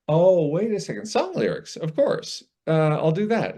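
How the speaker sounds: high, delighted self-quote; sudden realization. Retold or imitated. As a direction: act out an idea suddenly dawning. Acts out his sudden past realization.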